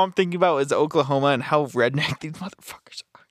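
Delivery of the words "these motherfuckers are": whisper. Real, not acted. Laughing aside about rednecks.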